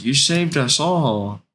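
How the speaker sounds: high, drawn-out mock gushing; happy son. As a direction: gush it like a grateful kid. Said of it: "he's so happy".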